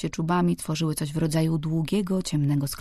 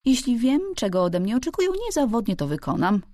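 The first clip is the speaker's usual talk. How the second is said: lightly voiced housekeeper, pitched up; reply as the housekeeper, a little higher; housekeeper's voice; 6 semitones higher than usual. A narrator's reading. Housekeeper answers the old servant.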